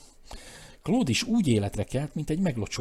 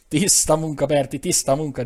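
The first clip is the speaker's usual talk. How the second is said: airy, pleased boast, louder and higher; boasting lightly; 5 semitones higher, 6 dB louder than usual. A narrator's reading. Eustace bragging to Bertie, lightly chuckling. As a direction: crow about it with a light chuckle in the voice. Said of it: "dicsekedett Eustace könnyedén kacarászva".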